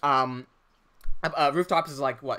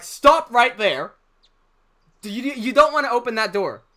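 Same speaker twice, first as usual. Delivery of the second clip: louder, higher cautioning; retold urgent warning; 5 semitones higher, 6 dB louder than usual. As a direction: act out stopping a friend at the door.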